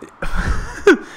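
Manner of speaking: short high-pitched laugh. Real, not acted. Fan watching the manager rage at umpires, amused laugh. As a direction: let out a brief laugh, high and airy.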